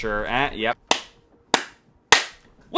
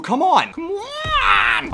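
rising urgent plea; pleading gamer; much slower than usual. Real, not acted. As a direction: beg the hit to land. Urging the game to register.

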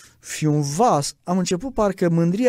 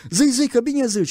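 higher, eager call of encouragement; mock shout; 8 semitones higher than usual. Believers shouting encouragement at the seeker, imitated eager call. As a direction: egg the man on like the crowd did. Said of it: "strigau alții".

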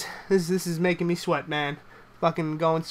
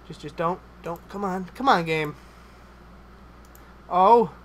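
normal talking voice

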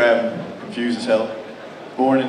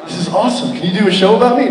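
high, giddy mock excitement with a laugh; mock excited; 7 semitones higher than usual. Comic imitating himself gushing at the Discovery Channel, excited. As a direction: gush like you've just been discovered. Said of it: "I'm like".

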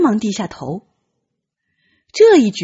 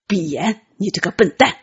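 gruff command with a scolding tail; angry order; 3 semitones lower than usual. Acted. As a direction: snap the order, then scold him. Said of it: "他怒道". (生气地命令，带着斥骂)

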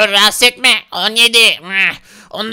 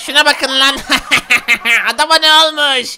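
laughing through the words; mocking laughter. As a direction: jeer between peals of acted laughter. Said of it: "Gülmeyin bana". (kahkahayla alay ederek)

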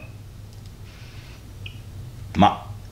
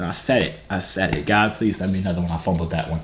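ordinary talk, nothing marked

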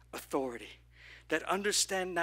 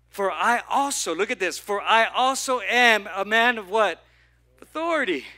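louder call and response; preaching at full force; building preach; 2 semitones higher, 8 dB louder than usual. Acted. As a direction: shout it out to the church.

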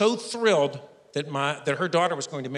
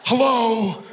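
Bernie Sanders; 8 semitones higher, slower than usual. Acted. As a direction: play the oxygen-starved brain as a cranky politician. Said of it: "my brain sounds like Bernie Sanders".

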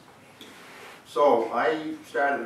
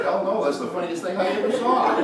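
laughing; 10 semitones higher, 5 dB louder than usual. Retold or imitated. Laughing retort in story.

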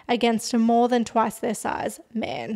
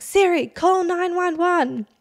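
higher, drawn-out yell; retold yell; 7 semitones higher, 4 dB louder, slower than usual. Bree yelling at Siri after it rejects her voice, imitated raised yell. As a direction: call it out slowly and high, imitating a desperate yell. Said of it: "like, yells and she's like".

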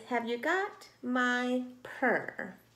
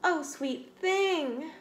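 slow, tender mother; 7 semitones higher, 4 dB louder, much slower than usual. Acted. Mother tenderly greets her tired kitten. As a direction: say it slowly and lovingly. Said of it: "said her mother tenderly".

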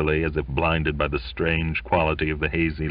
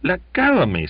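annoyed son; 13 semitones higher, 5 dB louder, faster than usual. A narrator's reading. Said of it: "said Charlie".

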